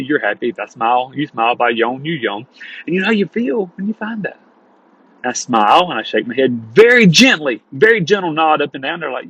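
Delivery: usual speaking voice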